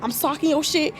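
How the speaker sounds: loud, fired-up trash talk at the monster; mock threats. Real, not acted. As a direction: threaten it like you mean to fight. Threatening the story character.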